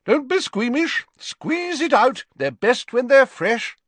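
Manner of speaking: high-pitched brisk teacher's call. A narrator's reading. Professor urging squeamish students on, calling out. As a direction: call out high and strong to the class. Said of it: "called Professor Sprout".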